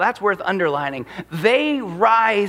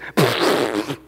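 mocking laugh; 9 semitones lower than usual. Shown as a demonstration. Sound of derision demo.

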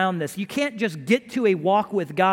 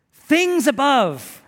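Things above slow, much higher and louder; emphatic fronting; 4 semitones higher, 6 dB louder, much slower than usual. Shown as a demonstration. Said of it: "this is how it would read".

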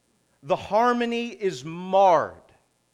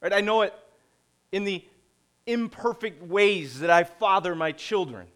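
ordinary delivery

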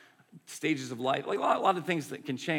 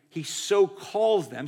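"so calls" punched louder and higher, slowed right down; stress on so calls; 5 semitones higher, 7 dB louder, much slower than usual. Shown as a demonstration. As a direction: hit "He so calls them" slowly with "calls" landing hard. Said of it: "I'll read it again".

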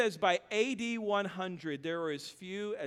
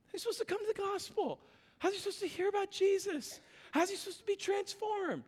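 mock exasperated high voice; 10 semitones higher, faster than usual. Preacher mocks hypocrisy, rising pitch.

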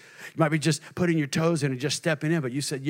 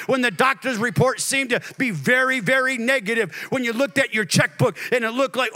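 full-force preaching; 9 semitones higher, 4 dB louder than usual. Acted. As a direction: thunder it out to the congregation.

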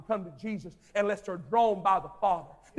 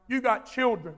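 ordinary talk

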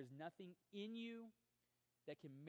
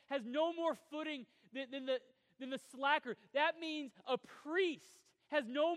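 loud, high, driving sermon delivery; preaching at full force; 8 semitones higher, 12 dB louder than usual. Acted. Pastor to his congregation, preaching at full force. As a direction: preach it out to the whole room.

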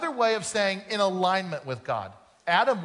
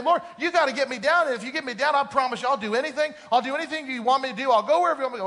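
high, louder desperate begging; panicked pleading; 6 semitones higher, 4 dB louder, faster than usual. Retold or imitated. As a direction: bargain with God as if your life depends on it. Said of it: "he started paying".

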